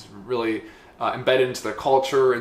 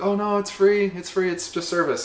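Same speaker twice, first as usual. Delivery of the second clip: higher-pitched imitated polite refusal; polite shop lady; 8 semitones higher than usual. Imitating the matcha lady refusing payment, high polite voice. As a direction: lightly play an insistent, gracious host.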